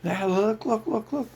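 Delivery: high, pushy mock "look, look"; lightly voiced mocking pitchman; mocking puppet-master voice. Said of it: "They're like".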